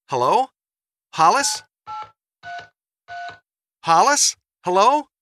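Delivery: loud, high, rising calls; calling out questioningly. Acted. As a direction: call for your husband down the line. Mother calls out to interrupting husband.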